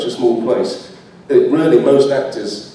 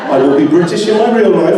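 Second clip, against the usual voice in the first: laughing, louder and higher; laughing reassurance; 5 semitones higher, 5 dB louder than usual. Real, not acted. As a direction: promise it through real laughter. Laughing apology to fans.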